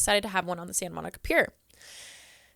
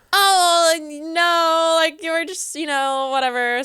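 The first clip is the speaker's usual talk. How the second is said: awkward reassurance; 7 semitones higher, 5 dB louder, slower than usual. Retold or imitated. Covering for empty meetup.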